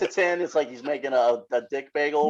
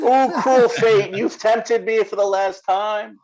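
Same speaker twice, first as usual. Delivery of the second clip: slow, loud, high theatrical lament; hammy stage actor; 5 semitones higher, 5 dB louder, slower than usual. Pretend. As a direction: declaim it like an old-time stage villain. Mock melodrama over Elmo doll.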